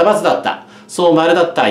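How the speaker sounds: ordinary delivery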